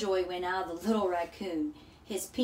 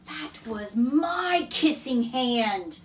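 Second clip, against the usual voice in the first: slow, hurt little raccoon, louder; a crushed child's cry of loss; saddest voice; 4 semitones higher, 7 dB louder, slower than usual. A narrator's reading. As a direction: heartbroken child protesting. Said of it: "in the saddest voice".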